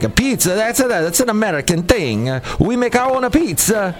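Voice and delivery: playful mock Italian scoffing at American pizza; Italian accent. Italians scoffing at American pizza, hammed-up Italian accent. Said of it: "my wonderful Italian accent".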